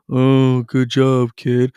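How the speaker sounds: flat, half-hearted "good job" with no pitch lift; distracted zombie praise. Shown as a demonstration. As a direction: praise your kid while glued to your phone. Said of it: "blindly watching like a zombie".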